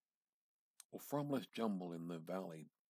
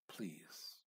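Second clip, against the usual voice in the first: whispered plea; 4 semitones lower, 8 dB quieter than usual. A narrator's reading. Narrator pleads softly.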